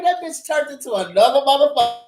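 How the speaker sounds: ordinary talk, nothing marked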